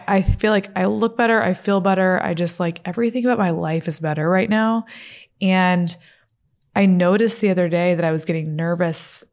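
ordinary talk

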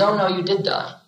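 lightly voiced guide, amused; gentle otherworldly voice. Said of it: "And the voice says".

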